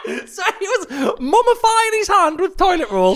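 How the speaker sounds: high-pitched, helpless laughing speech; laughing hard. Real, not acted. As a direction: barely get it out through real laughter. Laughing at the listener's story.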